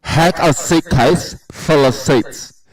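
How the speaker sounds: slow, muffled through a mouthpiece; wearing a mouthguard. Real, not acted. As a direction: talk around a mouth retractor. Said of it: "are you doing a voice".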